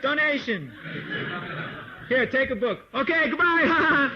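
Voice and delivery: laughing, rushed parody of a street distributor; mock hurried book pusher. Teacher parodying devotees on Sankirtan, laughing mock rush. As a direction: hand out the book and flee, laughing. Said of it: "you run around and give your mantra".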